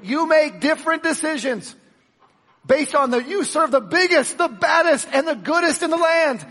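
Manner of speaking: high, loud preaching at full force. Acted. Preacher to his congregation, full-force preaching. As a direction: preach it out big to the crowd.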